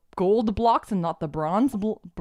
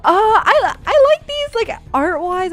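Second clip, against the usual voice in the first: high, loud giggling through the quote; can't stop laughing while retelling; giggly high retelling; 11 semitones higher, 4 dB louder than usual. Real, not acted. Laughing retelling her reply.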